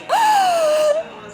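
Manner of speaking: sharp, high gasp of fright; startled gasp. Retold or imitated. Realtor and boys realizing the lights flash by themselves, high gasp. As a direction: suck in air in sudden alarm. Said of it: "And we're like".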